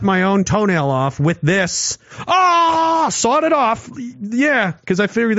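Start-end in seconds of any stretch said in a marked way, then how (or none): 2.3-3.1 long high "aah"; re-enacted pained cry. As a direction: cry out, drawn out, mimicking the sawing. Acting out sawing off toenail.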